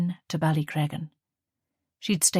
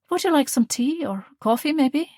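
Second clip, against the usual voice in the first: higher, louder, eager mother reminiscing; fond mother; 8 semitones higher, 4 dB louder than usual. Acted. Mother offering her daughter cake, eager and fond. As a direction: bustle about like a proud mum.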